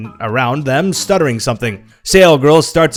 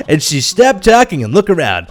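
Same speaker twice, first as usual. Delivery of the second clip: ordinary delivery, nothing marked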